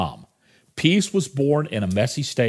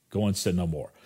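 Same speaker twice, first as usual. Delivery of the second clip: softer, lower repeat, letting it sink in; hushed repeat of Jesus' words; soft echo; 6 semitones lower, 7 dB quieter, faster than usual. Retold or imitated.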